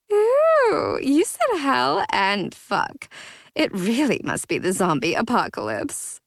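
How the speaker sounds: put-on giggling drunk. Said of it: "She giggled some more".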